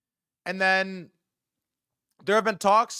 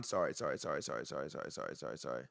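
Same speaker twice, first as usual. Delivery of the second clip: sinking lower and softer with each sorry; fading apologies; 14 semitones lower, 13 dB quieter, faster than usual. Real, not acted. Correcting his own slip, apologies trailing off lower and softer. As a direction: let the sorries trail away into nothing.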